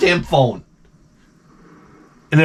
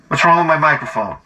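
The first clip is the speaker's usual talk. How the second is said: ordinary talk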